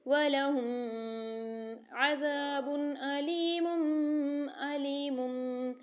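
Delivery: slow recitation holding the nasal meem in वलहुम and अलीमुम; tajweed demo. Shown as a demonstration. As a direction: draw the words out, humming the meem. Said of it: "हमने जो ये करा".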